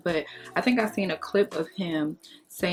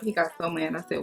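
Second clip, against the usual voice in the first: usual speaking voice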